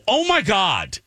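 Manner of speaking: high, hammed-up "Oh, my God"; dramatic gasp. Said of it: "I'm so dramatic".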